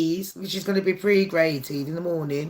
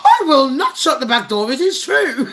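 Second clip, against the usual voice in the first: higher-pitched, emphatic refusal; mock indignation; 5 semitones higher, 4 dB louder than usual. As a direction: protest it as a comic, insistent retort. Acted indignant retort, repeated through laughter.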